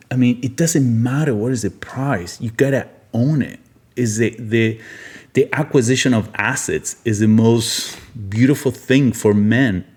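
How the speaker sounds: ordinary talk, nothing marked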